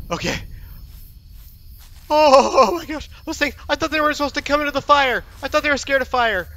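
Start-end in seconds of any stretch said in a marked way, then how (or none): 2.1-6.5 high-pitched, shaken disbelief; rattled protest. Real, not acted. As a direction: protest while still shaken by the scare. Rattled after attack in game.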